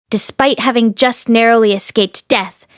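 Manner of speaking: plain reading voice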